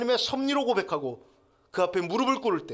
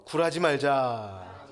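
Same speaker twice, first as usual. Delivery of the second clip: slow, drawn-out call to repeat; lead-in for repetition; 3 semitones lower, 4 dB louder, much slower than usual. Shown as a demonstration. Preacher leads congregation in repeating the point, slowly. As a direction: stretch each word so all can join. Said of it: "한번 따라하겠습니다".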